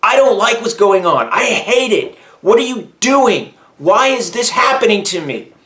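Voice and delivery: angry at God. Retold or imitated. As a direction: confront God with your hurt. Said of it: "I go up to God and say".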